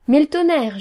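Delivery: indignant exclaimed oath; swearing. A narrator's reading. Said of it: "jura le prince de Grenouille".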